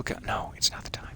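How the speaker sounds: breathy whisper to himself, quickening at the end; whispered aside. Real, not acted. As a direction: drop to a secret whisper, then rush it. Muttering aside to himself mid-play.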